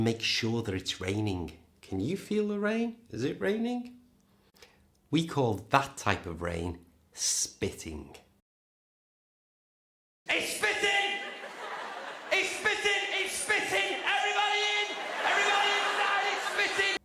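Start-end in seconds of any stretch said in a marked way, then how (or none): 1.8-4.0 much higher, puzzled questioning voice. Acted. Teacher acting out checking for rain, puzzled. 10.3-17.0 very high, squealing mock panic; hammed-up shriek herding everyone in. Teacher playing someone panicking over light rain.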